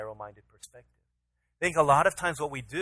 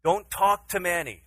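raised, louder voice, firm bedtime order; stern dad warning; 6 semitones higher, 6 dB louder, slower than usual. Retold or imitated. Father telling his son to stay in bed, stern. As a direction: spell out the rule for a small child.

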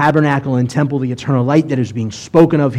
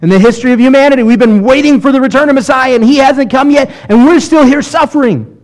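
whiny complaint; 9 semitones higher, 3 dB louder than usual. Retold or imitated. Believers complaining about long trial.

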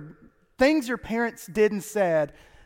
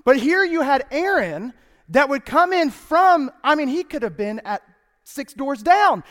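normal talking voice

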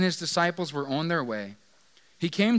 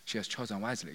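mock dojo master; 7 semitones lower, 11 dB quieter, faster than usual. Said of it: "You picture him kinda".

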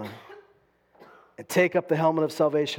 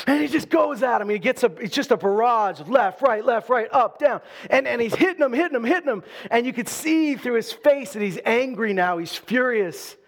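higher, louder preacher build-up; preaching at full force; 6 semitones higher, 4 dB louder than usual. Acted. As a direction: drive the barrage home to the crowd.

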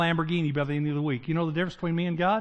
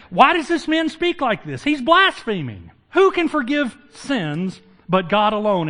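higher and louder, outraged accusation, slowing on blaspheming; scandalized religious leaders crying blasphemy; indignant Pharisees; 6 semitones higher, 6 dB louder, slower than usual. Acted. Pharisees objecting to Jesus, outraged and loud. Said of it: "And the Pharisees".

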